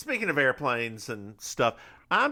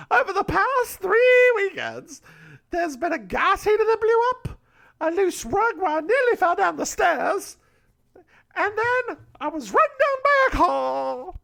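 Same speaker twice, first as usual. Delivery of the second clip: put-on high old lady voice; 6 dB louder than usual. Old woman tells JB someone tries to kill her, alarmed. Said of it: "why am I doing this?".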